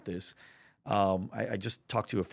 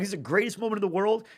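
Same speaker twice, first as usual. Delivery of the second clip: much higher, loud and elated; ecstatic; 10 semitones higher, 5 dB louder than usual. Retold or imitated. Friend at the Super Bowl with her dad, ecstatic. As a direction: shout out the joy of winning.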